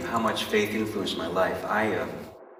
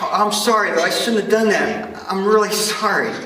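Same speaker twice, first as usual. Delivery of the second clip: loud apology; 11 semitones higher, 11 dB louder than usual. Acted. Acted boy apologizing.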